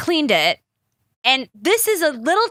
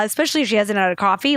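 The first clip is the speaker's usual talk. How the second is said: ordinary delivery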